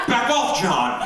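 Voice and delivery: high loud mock yell at grandpa. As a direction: shoo him off loudly. Kid yelling off grandfather.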